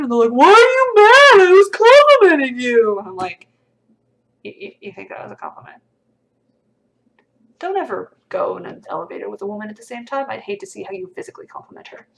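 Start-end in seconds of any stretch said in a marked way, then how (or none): 0.3-3.0 indignant mock yell. Streamer mimicking commenters who defend their compliments, indignant mock yell. 4.4-12.1 much softer, stammering start, quickened delivery; quiet disbelief. Retold or imitated. Streamer answering a commenter's idea of compliments, quiet disbelief.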